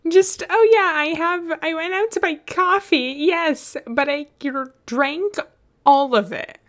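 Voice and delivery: high, loud mocking voice; mocking Beat. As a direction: parody a bad liar's excuse. Mocking Beat's excuse.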